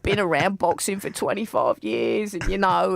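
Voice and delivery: a mild mock Cockney boxing bloke; a hint of a Cockney caller on talk radio; imitating Cockney caller. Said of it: "this Cockney guy".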